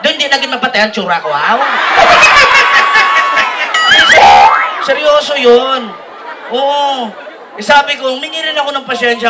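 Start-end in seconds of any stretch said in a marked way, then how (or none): 1.6-4.8 high, loud shrieking laugh. Real, not acted. Laughing at his own Ann Curtis story.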